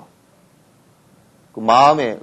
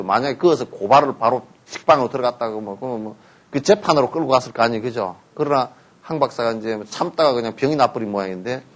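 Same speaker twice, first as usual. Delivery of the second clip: normal talking voice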